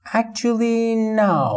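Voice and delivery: polite correction. Shown as a demonstration. Teacher models a polite correction to a stranger, slow and gentle. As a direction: stretch Actually out to soften the no.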